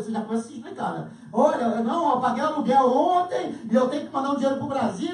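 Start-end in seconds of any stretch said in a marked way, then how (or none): 1.3-5.1 loud, fast flustered excuses; hasty excuse. Retold or imitated. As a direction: blurt excuses before she asks. Neighbor rushes to refuse money.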